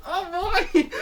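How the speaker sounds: very high, laughing squeal; laughing delight. Acted. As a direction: crack up with joy.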